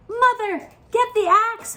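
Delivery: high, loud call for help; boy yelling to his mother from the beanstalk; urgent holler. Acted. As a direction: shout down to mother in panic. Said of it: "hollered Jack".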